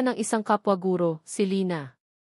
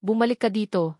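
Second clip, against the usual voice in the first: calling out; 3 dB louder than usual. A narrator's reading. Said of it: "sigaw ng isa sa kanila".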